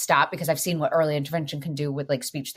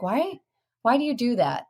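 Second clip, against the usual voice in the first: imitated child asking; 6 semitones higher than usual. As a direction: voice a puzzled child, pitched up. Voices child questioning mother in story.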